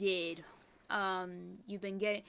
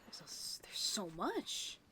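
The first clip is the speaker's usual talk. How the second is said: whisper. Real, not acted. Awed at the room.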